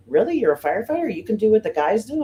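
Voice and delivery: mock disbelief, voice lifted high; surprised adult. Adults doubting woman firefighter.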